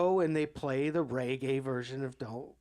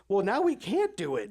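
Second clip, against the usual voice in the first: high, louder mock huff; singer's huffy reply; 8 semitones higher, 4 dB louder than usual. The singer gives up on the song, high mock huff. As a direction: sulk it out pitched up. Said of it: "And then she goes".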